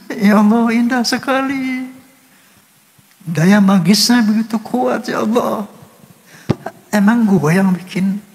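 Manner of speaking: high, tearful wonder; real crying. Real, not acted. Composer awed by his own song's power, crying. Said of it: "saya menangis".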